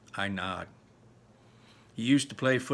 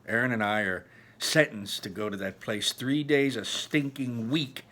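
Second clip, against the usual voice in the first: ordinary delivery, nothing marked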